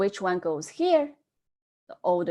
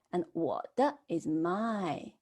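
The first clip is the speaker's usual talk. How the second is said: ordinary talk, nothing marked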